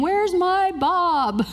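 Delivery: high, slow, whiny complaint; mock-whiny fan asking for Bob; disappointed audience member. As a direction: pout it like a let-down fan. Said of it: "I could hear people in my imagination saying".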